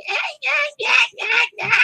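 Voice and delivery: very high-pitched mocking yapping; exaggerated squeaky nagging imitation; annoying coworker. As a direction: mimic her irritating voice way up high. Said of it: "That's how she sounds".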